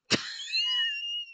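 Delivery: a squeaky burst of laughter; real giggle. Real, not acted. Laughs at own stumble.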